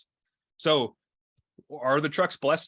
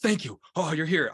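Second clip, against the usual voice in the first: imitated relief, slightly lifted voice; happy to see them; 4 semitones higher than usual. Patient welcoming the ambulance crew, mildly imitated happy relief. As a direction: greet rescuers with mild, happy relief. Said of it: "he's very happy to see us".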